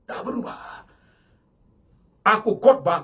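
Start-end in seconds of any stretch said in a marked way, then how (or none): 0.1-0.9 hushed, breath only, no voice; whisper. Real, not acted. Preacher whispers his vow to the congregation. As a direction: whisper Nggak berubah as a private vow.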